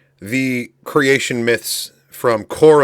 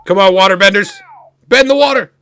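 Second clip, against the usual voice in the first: loud, high cheering on; rooting hard for the benders; 9 semitones higher, 8 dB louder than usual. Real, not acted. Cheering on the show.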